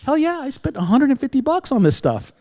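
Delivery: excited. Retold or imitated. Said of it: "he did this".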